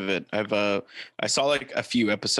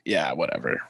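low, offhand shrug of a quote; imitated nonchalance; 6 semitones lower than usual. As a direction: mimic a veteran who can't be bothered. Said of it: "He's just like".

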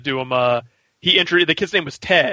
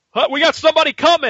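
excited lookout; 11 semitones higher, 4 dB louder, faster than usual. Retold or imitated.

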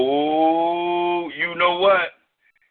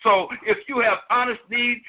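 higher, dramatic poetry recital; spoken-word poem; 6 semitones higher than usual. Acted. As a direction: perform it like a preaching poet. Recites his spoken-word piece.

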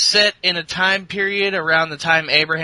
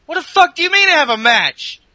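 much higher, louder furious outburst; angry wrestler shouting at an interviewer; outraged yell; 13 semitones higher, 7 dB louder than usual. Retold or imitated. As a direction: explode at the messenger. Kevin Owens outraged at interviewer.

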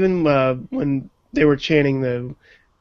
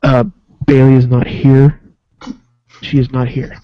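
flat PA-system announcer voice, a bit louder; intercom announcement; 3 semitones lower, 6 dB louder than usual. Retold or imitated. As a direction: announce it like over a building intercom. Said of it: "sounded like Michael Cole was on, like, an intercom going".

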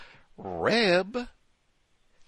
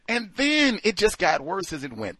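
ordinary delivery

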